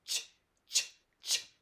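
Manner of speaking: crisp unvoiced ch bursts; repeated ch, ch; hard ch sound. Shown as a demonstration. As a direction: tap out a hard ch. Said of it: "C H has a hard C H, like".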